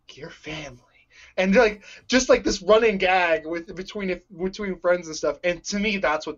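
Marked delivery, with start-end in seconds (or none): 0.1-0.8 hushed whisper. Retold or imitated. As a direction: whisper it like a secret. Acted quote, whispered punchline.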